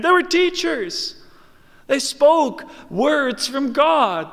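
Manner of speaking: high, forceful scripture preaching. Real, not acted. Preacher retelling the pleas at judgment, forceful preaching. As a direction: build the list of works with pulpit fervor.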